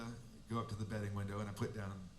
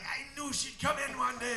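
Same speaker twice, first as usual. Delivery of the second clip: higher, a hint of an old man in the stands; half-voice the old fan in the checkered hat; lightly voiced old bettor; 11 semitones higher than usual. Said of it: "the old guy in the checkered hat".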